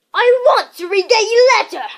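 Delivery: high, loud demanding whine; loudly. A narrator's reading. Dudley demands the letter from his father, loud. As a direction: demand it like a spoilt boy. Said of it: "he said loudly".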